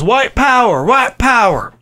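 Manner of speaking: high, hammed-up yell of the slogan. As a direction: bark it out the truck window. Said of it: "sticks his fist out the window and yells".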